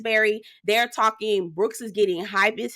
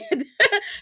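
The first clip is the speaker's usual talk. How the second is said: laugh; 10 semitones higher than usual. Real, not acted. Laughs at embarrassing moment.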